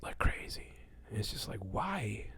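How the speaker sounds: hushed unvoiced whisper. Real, not acted. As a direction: drop to a confiding whisper. Confiding about cheating friends.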